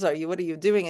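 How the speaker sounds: ordinary talk, nothing marked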